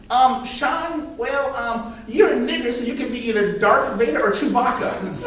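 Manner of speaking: put-on high childish taunting voice. A boy taunting his classmate, in a high childish voice. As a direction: play the smug little kid offering roles.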